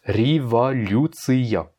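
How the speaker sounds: révolution slowly, revaliusea with ya kept at end; révolution drawn out, final ya kept; russian pronunciation. Shown as a demonstration. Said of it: "donc ça se prononce". (révolution lentement, le ya final gardé)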